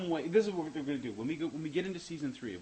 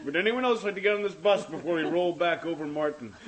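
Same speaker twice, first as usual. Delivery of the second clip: loud, higher, laughing call to passengers; laughing mock announcement; 5 semitones higher, 9 dB louder than usual. Offering others a ride over Martin, laughing mock announcement.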